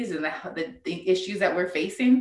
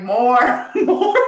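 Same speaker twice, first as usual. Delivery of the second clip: excited urging; 4 semitones higher, 7 dB louder than usual. Retold or imitated. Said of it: "She was like".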